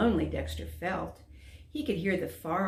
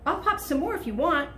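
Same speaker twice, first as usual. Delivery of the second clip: fast, loud, higher eager offer; quickly, anxious; 10 semitones higher, 5 dB louder, faster than usual. A narrator's reading. Lonely dragon quickly begging the animals to stay, fast. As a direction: rush it so they won't leave. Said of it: "said quickly for fear".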